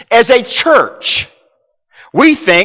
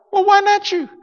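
God's reply; 13 semitones higher than usual. Retold or imitated. God answers the complainer.

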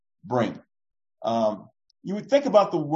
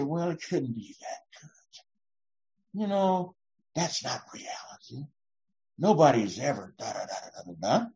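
mock pessimist; 3 semitones lower, 8 dB quieter than usual. Imitating a gloomy pessimist.

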